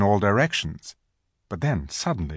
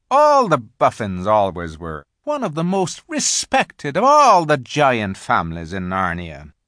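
lively faun voice with high swoops; animated storybook faun; chatty, knowing faun; 4 semitones higher, 4 dB louder than usual. A narrator's reading. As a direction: explain fondly, leaping up on key words. Faun explains giant families to Lucy.

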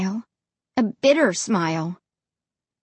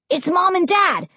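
put-on high-pitched eager boy; excited cry; 7 semitones higher than usual. A narrator's reading. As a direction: cry out in relief. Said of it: "Josh cried".

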